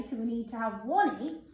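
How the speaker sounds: ordinary talk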